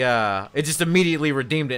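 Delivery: ordinary delivery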